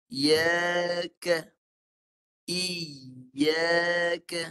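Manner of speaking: Iyaka with a crisp, short i sliding up into ya; correct iyaka. Shown as a demonstration. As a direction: clip the i in Iyaka to one beat. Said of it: "tengah lidah naik".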